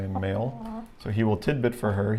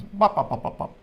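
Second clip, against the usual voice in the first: cat alarm cluck imitation; 9 semitones higher, 3 dB louder than usual. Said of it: "He goes".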